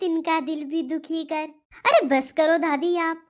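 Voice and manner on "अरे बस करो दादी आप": louder, exasperated cut-off; annoyed outburst. Acted. Granddaughter cuts off her grandma, exasperated and loud. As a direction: shut her down, fed up.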